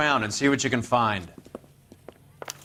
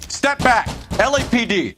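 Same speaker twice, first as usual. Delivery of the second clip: loud, higher command to the crowd; commanding call; 10 semitones higher, 5 dB louder than usual. Acted. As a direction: order people aside with authority. Detective forcing way through crowd.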